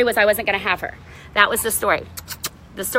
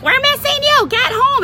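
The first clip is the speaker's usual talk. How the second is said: high mock pleading, much higher; whiny pleading friends; 12 semitones higher, 3 dB louder than usual. Calling her home at five a.m., high mock pleading. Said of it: "everyone's like".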